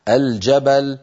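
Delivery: الجبل as "al-jabal", L clearly sounded; classical izhar. Shown as a demonstration. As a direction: say الجبل with a distinct L before j. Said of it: "الفصيح أن نقول".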